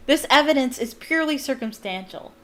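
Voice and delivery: plain reading voice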